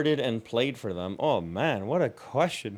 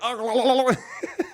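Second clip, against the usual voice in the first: high laugh; 9 semitones higher than usual. Retold or imitated. Girl stuck on slide.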